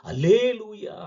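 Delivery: a loud, high shouted hallelujah; mock hallelujah shout. Preacher mocking loud worship with a shouted hallelujah. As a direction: shout it as if tearing your throat. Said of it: "Thondai கிழிய கத்துவதை".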